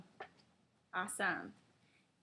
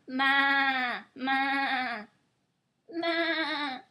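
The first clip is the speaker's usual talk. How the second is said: louder, drawn-out baa-like "ma" calls; mock lamb bleat; 6 dB louder, much slower than usual. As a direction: bleat like a little lamb for the toddler. Mother mimics lamb bleat.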